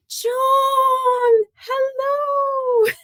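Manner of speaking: very high, drawn-out, delighted call; excited squealed greeting. Real, not acted. Host greets her cousin joining the live, excited squeal.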